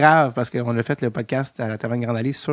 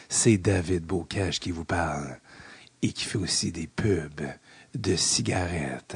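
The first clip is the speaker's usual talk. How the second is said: low, slow raspy radio host voice; groggy morning announcer voice with vocal fry; 6 semitones lower, 5 dB quieter, slower than usual. Pretend. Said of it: "c'est ma voix du matin".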